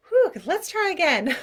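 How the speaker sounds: loud, high burst of relief; relieved restart. Pretend. Coming back to the conversation, loud and relieved. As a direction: whoosh it out and reset. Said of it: "You can come back and be like".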